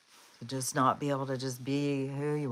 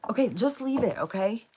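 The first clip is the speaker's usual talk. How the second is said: high, louder order to a dog; real fed-up pet command; exasperated command; 7 semitones higher, 5 dB louder than usual. Real, not acted. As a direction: tell the dog firmly to quit it.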